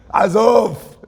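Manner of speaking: loud, high shouted brush-off; forceful dismissal. Retold or imitated. Boy answering the one who mocks the rabbis, forceful dismissal.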